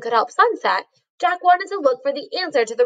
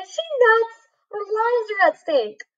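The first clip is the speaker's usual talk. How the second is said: put-on alarmed boy voice; 9 semitones higher than usual. A narrator's reading. Jack thinking in alarm.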